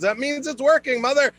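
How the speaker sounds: lightly voiced know-it-all daughter; mock bossy daughter. Daughter brushing off her mom's pain, mock bossy. Girlfriend voiced in retold story.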